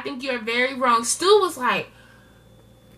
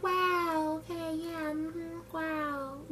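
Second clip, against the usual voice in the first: unimpressed Michelle; 4 semitones higher, 13 dB quieter, much slower than usual. Retold or imitated. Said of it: "Michelle's like".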